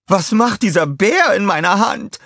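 high, loud, startled villainess; a high, loud yell of shock; Scarlett shouting. A narrator's reading. Scarlett finds the teddy in her hand and yells. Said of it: "schrie sie".

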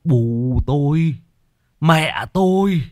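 slow, halting husband's voice; shaken husband. A narrator's reading. Husband tells wife his mother returned, slow and shaken. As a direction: drag the words out as if still shaken.

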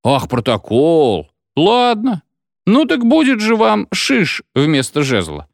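slow, drawn-out indignant echo; drawling, vengeful comeback; spiteful retort. A narrator's reading. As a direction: throw the demand back with menace. Angered figure mocks the demand.

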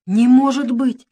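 high, loud, slow horrified gasp; truly shaken, gasp it out; frightened Rat gasping at bad news; real gasp of shock. A narrator's reading. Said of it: "ахнул он".

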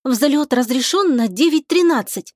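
ordinary delivery

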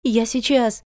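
high-pitched quick call; eager cry. A narrator's reading. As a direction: call out that you're on your way. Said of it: "закричала Натка".

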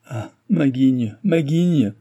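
hammed-up despairing wail; groaning lament. A narrator's reading. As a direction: moan over his bad luck. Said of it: "gémit-il".

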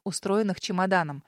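ordinary delivery

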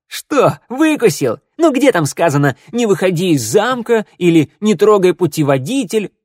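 loud mocking gloat; taunting. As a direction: rub it in his face.

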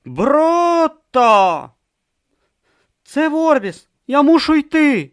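high, loud urgent warning; urgent character voice. Acted. Tortoise god urgently telling Brutha to leave.

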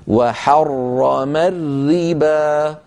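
very slow chant, full dark ra in وحرم, thin ra in الربا; heavy then light ra. Shown as a demonstration. Teacher asks students to listen, heavy وحرم, light الربا. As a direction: contrast thick وحرم with light الربا, drawn out. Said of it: "ففخموا المفخم ورققوا المرقق".

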